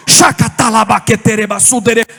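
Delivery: loud, rapid tongues demo; loud glossolalia burst; speaking in tongues. Shown as a demonstration. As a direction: pour out the syllables in a burst of prayer. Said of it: "desse jeito".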